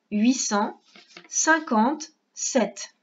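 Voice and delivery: huit cent, pause, then cinquante-sept very slowly; split dictation. Shown as a demonstration. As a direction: cut the number in two chunks for the child. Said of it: "On écrit".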